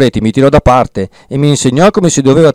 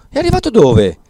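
startled question; 12 semitones higher than usual. Acted. Don Abbondio startled, asks.

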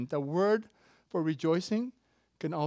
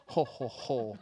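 low, softer ho-ho-ho; Santa laugh; 7 semitones lower, 5 dB quieter, slower than usual. Acted. God as the real Santa, low soft laugh. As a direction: chuckle low like Santa Claus. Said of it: "the real Santa Claus".

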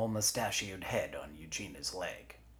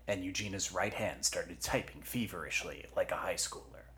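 ordinary delivery

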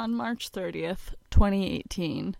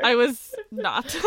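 laughing; 9 semitones higher, 5 dB louder, much slower than usual. Real, not acted. Laughing denial between friends.

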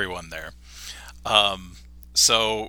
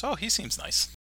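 much higher, softer mock Homer; Homer's timid remark; 12 semitones higher, 8 dB quieter than usual. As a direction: say it small, like Homer cowed. Homer quoted meeting Formica.

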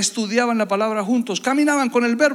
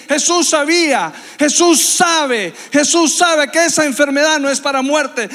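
louder, higher preaching that builds and hammers each line; preaching at full force; 5 semitones higher, 6 dB louder than usual. Acted. Preacher to his congregation about trusting Jesus, full-force preaching. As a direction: drive it home like a revival preacher.

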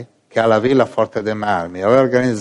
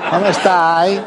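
mock on-air greeting; 8 semitones higher, much slower than usual. Mimicking on-air chat.

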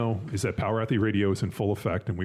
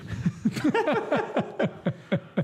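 high laugh. Real, not acted. Loud laugh at joke.

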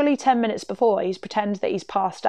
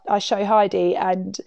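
ordinary delivery

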